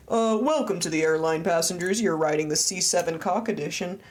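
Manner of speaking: a half-hearted cockpit address to passengers; pilot voice attempt. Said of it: "my cockpit voice".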